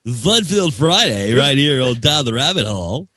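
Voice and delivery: over-the-top radio DJ hype; cheesy radio voice. Pretend. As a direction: sell it like a cheesy radio jingle. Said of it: "do it again with the cheese voice".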